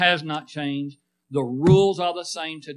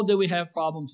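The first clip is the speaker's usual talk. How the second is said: normal talking voice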